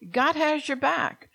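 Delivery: God has your back, high and slow with weight on has; emphatic reassurance. Real, not acted.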